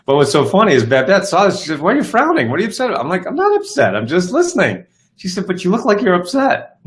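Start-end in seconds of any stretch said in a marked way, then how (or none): 1.8-3.0 high-pitched mock questioning; imitating questioning partner. Acted domestic exchange about frowning. 3.3-4.9 very high-pitched, hammed-up denial; mock protest. Acting out his protest to partner. 5.5-6.8 high-pitched mock insistence; imitating insistent partner. Acted partner insisting he looks upset.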